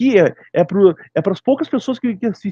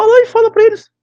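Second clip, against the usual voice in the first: mocking dare; 7 dB louder than usual. Real, not acted. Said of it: "dare to tell the Germans".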